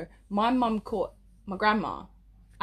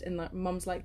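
normal talking voice

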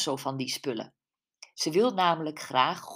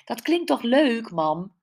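surprised protest, higher and louder; astonished daughter, pitch jumping up; 6 semitones higher, 5 dB louder than usual. Acted. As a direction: lift it up in surprise at mum. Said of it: "keek haar moeder verbaasd aan".

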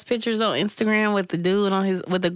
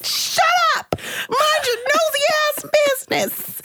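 very high hammed-up yell; tearful snapping. Crying woman in hospital to commenters, high mock yell. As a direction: shriek it at the nosy askers.

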